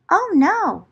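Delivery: plain reading voice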